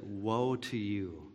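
hushed, slow woe with a heavy fall; solemn lament. Retold or imitated. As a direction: let it fall quietly, full of pity. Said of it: "And that's how he said it".

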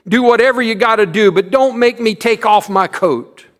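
put-on high, loud, fast pleading boy; begging. A narrator's reading. Jimmy begging the teacher to keep his coat, fast and loud. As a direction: plead in a rush not to undress. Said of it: "Jimmy begged".

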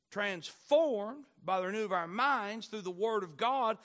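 ordinary delivery

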